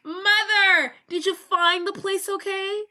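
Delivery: high, eager greeting to mother; hammed-up mama's boy voice. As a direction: pitch it up as a doting son. Doctor greets his mama in story.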